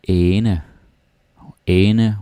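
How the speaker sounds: Ane said loudly, with a long open ae and a light final e; Danish ane sound. Shown as a demonstration. Teacher calls out Ane for learners to copy. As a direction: hold the ae in Ane long and clear. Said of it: "Then you got ane".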